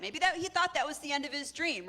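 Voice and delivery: usual speaking voice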